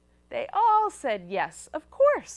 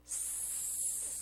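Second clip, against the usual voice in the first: soft, sustained sibilant hiss; long snake hiss; 12 semitones higher, 19 dB quieter than usual. Acted. Child greeting a pizza snake, with long soft hiss. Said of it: "What if it's a pizza snake?".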